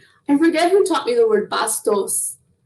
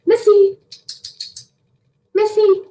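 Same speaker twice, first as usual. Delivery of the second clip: calling the cat; 10 semitones higher than usual. Real, not acted. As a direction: call her across the house.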